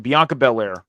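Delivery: ordinary talk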